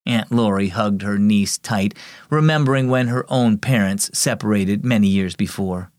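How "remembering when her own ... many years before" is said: plain reading voice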